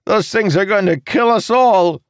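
high, loud panicked yell; screaming retreat. A narrator's reading. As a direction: scream it to the whole crew in panic. Jacob panics in sea battle.

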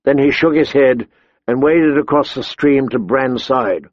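usual speaking voice

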